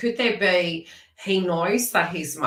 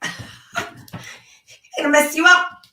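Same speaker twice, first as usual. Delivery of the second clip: laughing; 10 semitones higher, 7 dB louder, much slower than usual. Real, not acted. Laughing while teasing the viewer.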